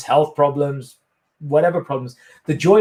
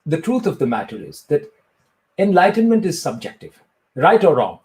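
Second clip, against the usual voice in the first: Indian-accented mock sage answering an interview question; Sadhguru impression. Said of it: "ask me a question as if I'm Sadhguru".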